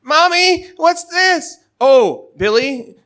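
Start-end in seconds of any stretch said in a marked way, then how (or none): 0.0-1.6 put-on child voice. Little Billy asking his mother about the candy, in a child voice. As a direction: squeak it like a small boy.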